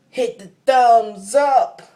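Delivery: high, loud, drawn-out call; stretched hype-up holler; rallying shout. Real, not acted. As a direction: belt it out slowly to the viewers. Calling out to viewers for likes.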